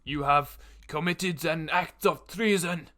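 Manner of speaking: slow, loud commanding proclamation; stern guard bellowing a verdict; guard shouting. Acted. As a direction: announce the sentence to the crowd. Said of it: "he shouts".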